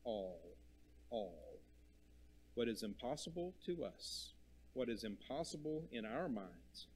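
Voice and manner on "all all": All said slow, soft and falling, each one set apart; stress on All. Shown as a demonstration. Preacher dwelling on the word All, hushed stress. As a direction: lean quietly into All, twice. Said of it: "Notice the stress on the word all".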